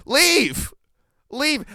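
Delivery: high-pitched order to get out; mock exasperated "just go"; acted command. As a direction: call it out high, drawing out the word. Acting out telling someone to leave.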